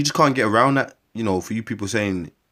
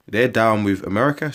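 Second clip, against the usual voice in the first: normal talking voice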